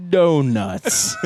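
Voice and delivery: loud, drawn-out slow-motion word; imitated half-speed punchline; half-speed playback. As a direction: blurt it like a slowed-down recording. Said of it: "and then he goes".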